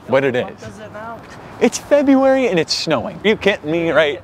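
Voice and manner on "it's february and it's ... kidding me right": surprised. Real, not acted. Snow in February, disbelief.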